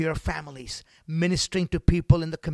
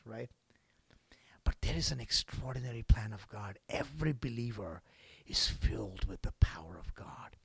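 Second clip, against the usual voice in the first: soft whispered confidence; 6 semitones lower, 8 dB quieter than usual. Acted. Preacher to believers, whispering. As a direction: hush and draw them in.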